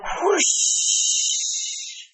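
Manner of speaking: breathy rushing train noise; whoosh. Acted. Narrator voicing a train rushing by, breathy whoosh. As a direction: blow it out like a passing train.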